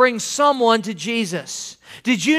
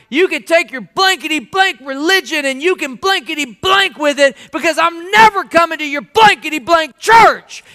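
high, loud mock tirade; imitated cussing out; 7 semitones higher, 4 dB louder than usual. Rough guy refusing a church invitation, mock-angry rant. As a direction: rant it, punching the censored swear words. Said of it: "he cussed me up one side".

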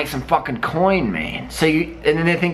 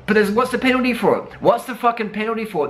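heated rant; 8 semitones higher, faster than usual. Real, not acted. Ranting about doping penalties.

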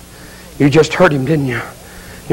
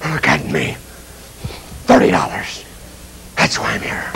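low, voiceless rasp of regret; spent, hollow murmur; hushed despair; 10 semitones lower, 5 dB quieter than usual. Acted. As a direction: barely voice it, broken by regret. Judas despairing in hell.

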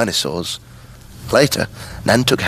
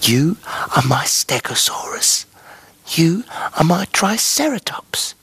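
whispered; 3 semitones higher than usual. A narrator's reading. Harry whispering the dinosaur names to his toys, hushed whisper. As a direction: whisper each name tenderly, like a secret. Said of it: "He whispered".

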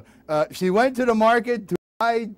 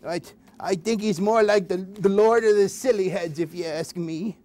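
normal talking voice

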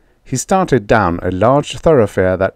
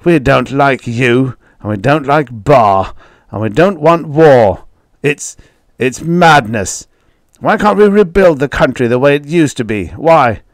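defiant; 5 semitones higher, 5 dB louder than usual. Acted. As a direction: hurl it back at him. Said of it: "stared defiantly".

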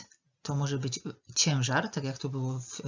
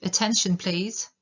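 higher, louder call to a roomful of people; 5 semitones higher, 5 dB louder than usual. Shown as a demonstration. Someone calling a chatting meeting to start, calling out. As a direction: call the meeting to order, voice held up through "please". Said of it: "zawołać".

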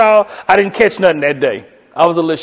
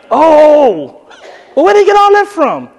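much higher, louder questioning; puzzled friend; 9 semitones higher, 6 dB louder than usual. Retold or imitated. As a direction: puzzle over it aloud.

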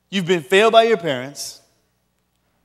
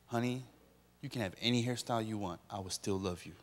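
much softer, soothing husband; tender dodge; 7 semitones lower, 15 dB quieter, faster than usual. Retold or imitated. Husband softly soothing his wife about her new hairstyle. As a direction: reassure her gently and dodge the question. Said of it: "How's my hair?".

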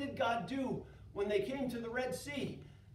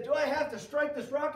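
louder exasperated question; frustrated Moses; 5 semitones higher, 6 dB louder than usual. Acted. Moses fed up, striking the rock. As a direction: grumble it in frustration.

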